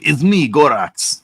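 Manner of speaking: high, slow put-on foreign accent; Gorax accent. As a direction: drag out me like a silly monster. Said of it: "I don't know why he has that accent".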